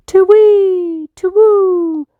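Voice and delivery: high, loud hooting owl cry; owl call. Acted. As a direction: hoot it out across the night forest. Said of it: "heard Owl's call".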